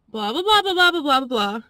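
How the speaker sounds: usual speaking voice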